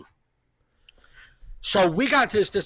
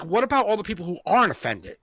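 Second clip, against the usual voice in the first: ordinary delivery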